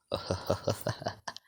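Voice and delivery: soft, breath-only villain laugh; ominous chuckle from nowhere; quiet evil laugh, building slightly; sinister laugh. Acted.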